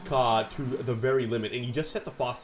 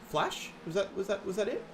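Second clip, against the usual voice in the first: teasing; 8 semitones higher, 4 dB quieter than usual. Real, not acted. Teasing the co-host's word choice.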